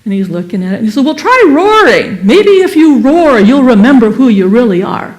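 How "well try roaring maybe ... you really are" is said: louder, higher encouraging lion voice; coaxing lion. Acted. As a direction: egg the cub on to roar.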